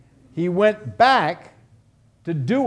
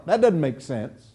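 usual speaking voice

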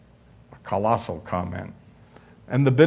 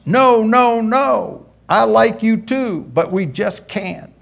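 high, louder hammed-up girl saying no; high girl's voice; 11 semitones higher, 7 dB louder, slower than usual. Girl refusing her date at the door, high mock pleading. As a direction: squeal out the refusals like a flustered girl.

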